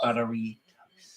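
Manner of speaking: slow, relishing buttery toast; dreamy food talk; savouring. Real, not acted. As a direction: linger over it like a treat.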